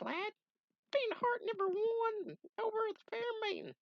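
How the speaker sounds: very high, fatherly put-on voice; squeaky old sage giving advice; high old mentor voice.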